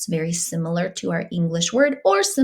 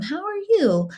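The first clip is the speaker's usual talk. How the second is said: "How are you?" asked way up high; lightly acted cheery greeting; high sing-song question; 11 semitones higher than usual. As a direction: mimic a friendly greeting, pitched high. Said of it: "say to me, if I ask them".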